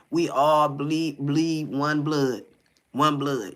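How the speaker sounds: each word "bleed" and "blood" drawn out slowly and leaned on; slow emphatic preaching. Real, not acted. Preaching unity, dragged out.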